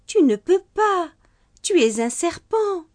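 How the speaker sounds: a mother gently refusing her son; firm motherly no; mother reasoning. Acted. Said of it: "Sa mère tenta encore une fois de le raisonner".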